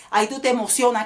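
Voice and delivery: ordinary talk, nothing marked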